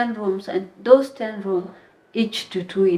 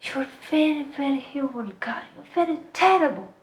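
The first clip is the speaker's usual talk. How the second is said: high-pitched imitation of a scolding; retold angry yelling; 8 semitones higher than usual. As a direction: act out her accusing tirade, without real shouting. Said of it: "she started yelling at me".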